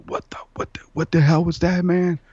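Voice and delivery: high, breathless retold panic; freaking out. Friends just knocked down by a shadow figure, freaking out. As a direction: start hushed, then burst out high in alarm. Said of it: "we're freaking out".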